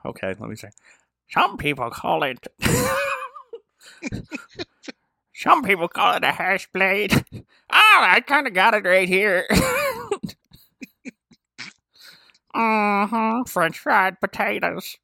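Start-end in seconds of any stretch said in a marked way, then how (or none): 1.3-7.2 lightly voiced Sling Blade character; Sling Blade impression. Friend asks for a Sling Blade impression, lightly voiced. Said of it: "Do your Brother Varner impersonation and put a little sling blade". 7.7-15.0 a light Sling Blade voice held through, slow at the end; hint of Sling Blade mumbling; Sling Blade impression. Friend asks for a Sling Blade impression, lightly voiced. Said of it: "put a little sling blade with it".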